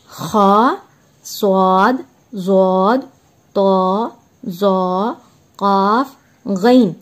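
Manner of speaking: each letter sounded slowly and clearly; teacher reciting Arabic letter names to students, measured and clear; Arabic letter recitation. Shown as a demonstration. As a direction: recite सौद, ज़ौद, तौ, ज़ौ, काफ, गैन one by one with pauses. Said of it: "ये हुर्फ मुस्तालिया है खा".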